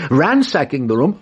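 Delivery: ordinary talk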